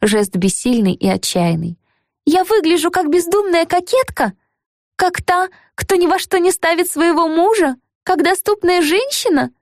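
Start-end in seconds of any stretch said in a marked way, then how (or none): 2.2-9.5 high, trembling, upset. A narrator's reading. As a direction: voice shaking with hurt, much higher. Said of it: "Голос у неё задрожал".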